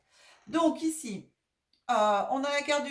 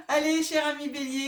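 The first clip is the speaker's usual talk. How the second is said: laughing greeting, pitched up; 5 semitones higher, 6 dB louder than usual. Real, not acted. Laughs while greeting her audience. (salutation en riant)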